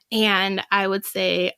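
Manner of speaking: ordinary talk, nothing marked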